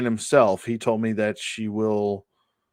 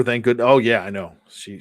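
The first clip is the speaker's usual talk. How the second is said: ordinary talk, nothing marked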